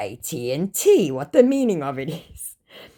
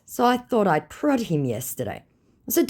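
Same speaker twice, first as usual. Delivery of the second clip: normal talking voice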